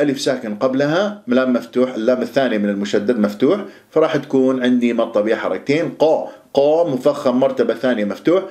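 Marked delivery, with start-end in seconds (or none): none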